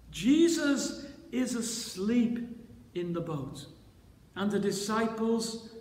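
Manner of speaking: ordinary talk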